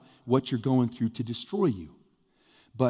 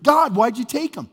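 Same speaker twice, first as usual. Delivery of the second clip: loud, high demand of God; raised voice, confronting God in anger; angry grief; 10 semitones higher, 7 dB louder than usual. Acted. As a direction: act out a bereaved accusation. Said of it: "there's some anger in it".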